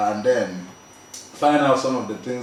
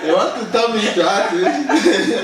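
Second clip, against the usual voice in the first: laughing; 8 semitones higher, 6 dB louder than usual. Real, not acted. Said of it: "laughing at the director".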